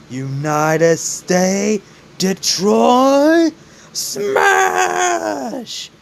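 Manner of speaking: attack name building from slow to a high, held yell; battle cry. Acted. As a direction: draw it out and climb high on the final word. All Might's attack cry, acted.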